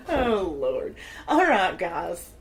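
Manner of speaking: normal talking voice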